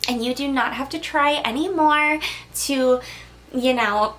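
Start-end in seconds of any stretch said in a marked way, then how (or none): none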